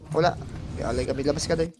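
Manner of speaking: normal talking voice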